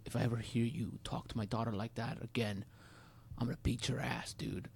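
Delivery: understated imitation of a threatening father. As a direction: act out a quiet, controlled threat. Dad threatens teen, retold.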